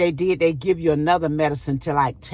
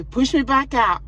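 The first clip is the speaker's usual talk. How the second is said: higher and louder insistence; insistent repeat; 10 semitones higher, 4 dB louder than usual. Retold or imitated. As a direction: repeat it, now firmer and pleading.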